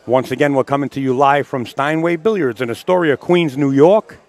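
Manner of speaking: loud, projected live-broadcast plug; announcer sign-off. Real, not acted.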